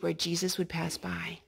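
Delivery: hushed storyteller whisper. A narrator's reading. Reading to a child, a hushed whisper. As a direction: breathe it like a secret.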